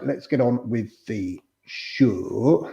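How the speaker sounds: drawn-out, slow northern vowels on "show"; a touch of northern English, unhurried; northern accent. Pretend. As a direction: slip into a light northern accent. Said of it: "speaking a northern accent".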